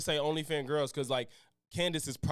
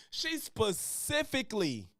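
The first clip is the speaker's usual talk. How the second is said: ordinary delivery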